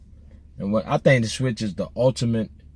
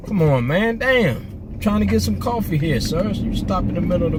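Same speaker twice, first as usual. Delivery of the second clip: sudden high exasperated call-out; pitched-up annoyed complaint; 7 semitones higher than usual. Real, not acted. Annoyed at driver while getting coffee.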